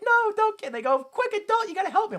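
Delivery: put-on very high panicked child; squeaky kid voice. Kid begs an adult to stop a fight, squeaky child voice.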